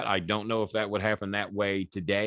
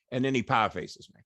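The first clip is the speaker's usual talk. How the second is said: normal talking voice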